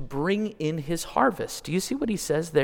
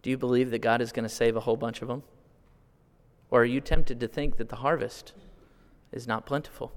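ordinary talk

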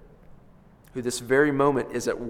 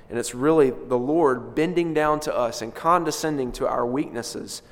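normal talking voice

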